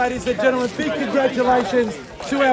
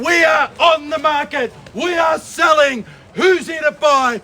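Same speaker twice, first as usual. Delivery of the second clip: auctioneer shout; 2 semitones higher, slower than usual. Real, not acted. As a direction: rouse the crowd to bid. Auctioneer rallying crowd.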